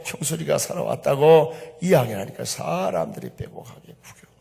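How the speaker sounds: usual speaking voice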